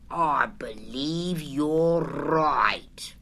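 very slow, whispered, sighing resignation; sad sigh. A narrator's reading. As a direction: let it out on a long sad breath. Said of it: "with a long, sad sigh".

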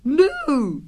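high, loud put-on voice; different disguised voice. A narrator's reading. Rabbit hiding from Pooh, disguised high voice. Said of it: "in a different sort of voice this time".

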